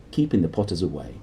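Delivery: ordinary delivery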